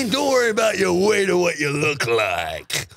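high, shouted rally rant; Jack Nicholson impression. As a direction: rant like a riled-up rally speaker. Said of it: "sounded like Jack Nicholson".

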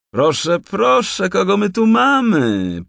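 high, loud mocking forester; forester calling out. A narrator's reading. Forester taunting the trapped raccoon, mock call. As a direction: tease the trapped raccoon, drawn out. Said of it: "zawołał leśniczy".

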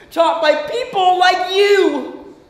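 high, slow, forceful blame; angry accusation. Acted. Accusing mother in quarrel.

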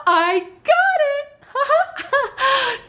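squealing with a laugh; high-pitched triumphant cry; excited squeal. Real, not acted. Triumphant reveal of purchase.

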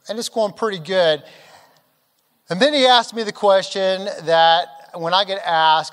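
ordinary delivery

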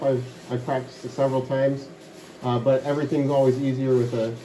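muffled by enclosed box. Real, not acted. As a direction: voice boxed in plastic. Seen 3: Clear plastic tote with blue neck shroud covers his head throughout.

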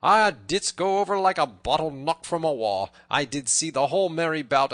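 loud, high, merry jibe; mirthful teasing. A narrator's reading. Robin teases fallen Little John, mirthful. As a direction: crow over his tumble. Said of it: "as well as he could for his mirth".

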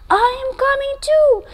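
high, small little-brother voice; little brother Monty. Acted. Monty tells his big brother he is coming too, in a high little voice. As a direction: chirp it like a tiny tagalong.

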